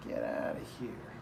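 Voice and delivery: ordinary talk, nothing marked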